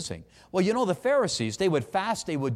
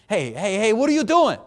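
mock scolding; 5 semitones higher, 6 dB louder than usual. Imagined parent scolding child.

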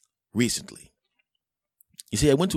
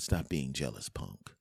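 cool put-down; 4 semitones lower, 7 dB quieter, slower than usual. Retold or imitated. Replying to jealous friend, low and cool. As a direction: lower and softer, fading away.

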